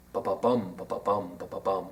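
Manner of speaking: low, soft "Ba-ba-bum" with the stress on bum; anapestic beat. Shown as a demonstration. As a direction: tap out "Ba-ba-bum" quietly, weak-weak-STRONG. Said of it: "This is called an anapest".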